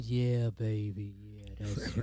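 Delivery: low, soft mock baritone voice. Host pretends to be a baritone, low and soft. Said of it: "I wish I was a baritone".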